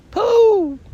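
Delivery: squeaked. Acted. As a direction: squeak the name up out of the pit. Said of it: "squeaked the voice".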